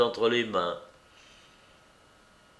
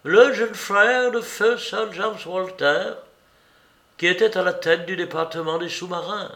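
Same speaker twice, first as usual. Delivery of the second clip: lightly voiced Holmes, brisk and higher; Holmes explaining; 7 semitones higher than usual. A narrator's reading. Holmes briefing his brother, brisk and higher. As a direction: rattle off the identity quickly.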